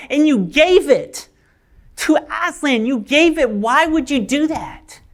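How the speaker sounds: high-pitched, building reproach; distraught outburst. Acted. Polly berates Diggory for giving up the apple, distraught.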